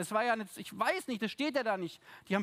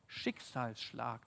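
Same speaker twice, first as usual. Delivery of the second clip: dramatic hush; 8 semitones lower, 8 dB quieter, much slower than usual. Acted. Dramatic summing-up word.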